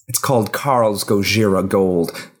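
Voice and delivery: normal talking voice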